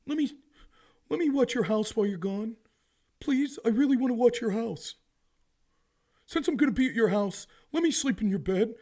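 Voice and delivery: high, loud pleading moocher voice; wheedling freeloader. Retold or imitated. Freeloader begging homeowner to move in, wheedling. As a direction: wheedle like a pushy houseguest. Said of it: "it's the Hurley boy argument".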